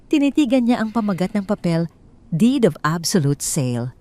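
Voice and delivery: plain reading voice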